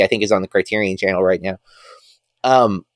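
ordinary delivery

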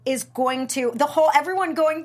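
normal talking voice

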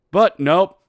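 loud, punchy "But nope"; emphatic nope. Real, not acted. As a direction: bark out "But nope" at the refs. Snapping at the call.

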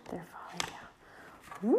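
slow, hushed storytime whisper; slow dramatic whisper. Acted. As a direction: whisper it like a secret to the babies. Reading, echoing child in hush.

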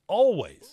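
Always said high and loud; stressed Always; emphatic always. Real, not acted. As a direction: punch the word Always. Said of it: "emphatic repeat".

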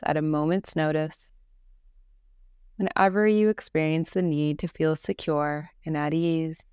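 calm, even meditation voice with long pauses; guided meditation. Acted. Leads a Yoga Nidra meditation.

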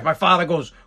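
normal talking voice

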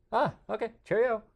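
high, prim mock-British voice; Bigfoot as posh Brit. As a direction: play a polite English gentleman. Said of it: "Bigfoot looked at him and goes".

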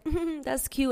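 high, sarcastic mm-hmm. Retold or imitated. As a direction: brush it off with mock sweetness. Sarcastic reply to lowball offer.

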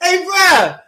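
incredulous. Real, not acted. Host recovering from laughing fit.

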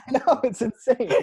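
laughing. Real, not acted. Laughing agreement among hosts.

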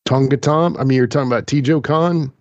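ordinary talk, nothing marked

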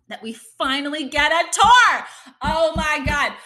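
high loud real excitement; thrilled. Real, not acted. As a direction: burst out with joy. Tour excitement.

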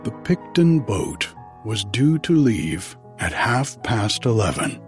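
story narration. Acted. Begins reading the story.

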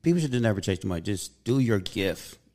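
usual speaking voice

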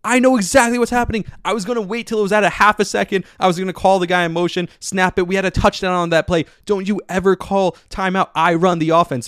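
high, loud furious tirade; retold angry yell. Rodgers yelling at the coach over the timeout, high furious shout. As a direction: yell it like a quarterback chewing out his coach. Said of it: "Rodgers comes yelling over the side".

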